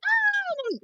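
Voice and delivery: very high drawn-out "ah"; high, sustained mock scream; mock squeal. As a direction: imitate the callers' high squeal. Retelling the callers' excited outcry.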